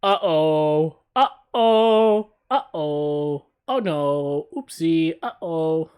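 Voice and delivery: worried uh-oh, rising and loud; nervous uh-oh. Real, not acted. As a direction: dread creeping in. Reacting to being spotted in game.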